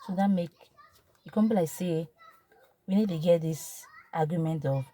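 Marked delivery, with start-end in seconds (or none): none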